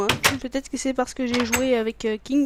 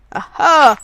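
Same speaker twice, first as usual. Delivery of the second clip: loud sudden startled shout; startled cry; 3 semitones higher, 13 dB louder than usual. Real, not acted. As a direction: jump at the surprise. Surprise attack in game.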